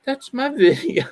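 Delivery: high voice breaking into laughter; amused, high-pitched quote dissolving into a laugh; laughing realisation. Retold or imitated. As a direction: retell the punchline while cracking up. Laughing at the retold punchline.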